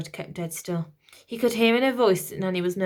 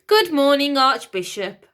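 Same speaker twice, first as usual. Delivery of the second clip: loud, higher robot greeting; robot announcing; 6 semitones higher, 8 dB louder than usual. Acted. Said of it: "the robot announced".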